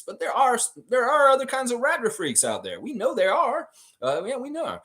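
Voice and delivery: high-pitched, teasing insistence; hint at something naughty, voice way up; knowing innuendo. Real, not acted. Knowing innuendo about other freaks.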